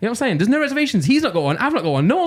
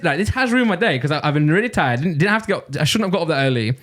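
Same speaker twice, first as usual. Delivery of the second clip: normal talking voice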